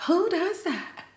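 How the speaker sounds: high, disbelieving question; high-pitched disbelief; incredulous. Retold or imitated. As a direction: ask it like you can't believe it. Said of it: "I'm just like".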